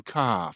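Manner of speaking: "ka" with no R at the end. Shown as a demonstration. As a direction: say "ka" for car, dropping the R. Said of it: "written but not pronounced".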